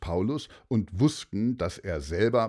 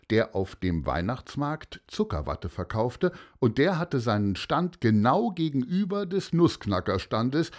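ordinary delivery

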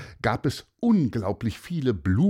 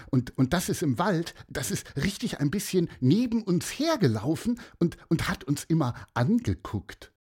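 put-on higher, eager kid voice; excited boy; 8 semitones higher than usual. A narrator's reading. Leon tells the bird about the deer, eager kid voice. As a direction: tell it like a thrilled little boy.

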